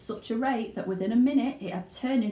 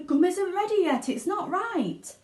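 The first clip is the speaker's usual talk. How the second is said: higher fussy inventor's voice; Mr Wonka; 11 semitones higher than usual. Acted. Wonka warns the chewing girl, fussing. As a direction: fret over the unfinished gum.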